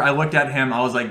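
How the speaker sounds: ordinary talk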